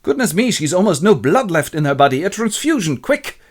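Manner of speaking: alarmed professor, high and wavering in pitch; Van Helsing. Acted. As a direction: exclaim in hammed-up alarm, rising to urgency. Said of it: "examines her and goes".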